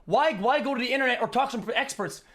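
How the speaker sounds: mock the appeal to experience; sarcastic retort. Real, not acted. Mocking Tate's reliance on experience, heated sarcasm.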